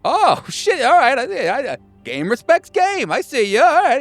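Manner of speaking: very high-pitched hammed-up reaction; impressed backing down. Man conceding respect to a rival, high hammed-up awe.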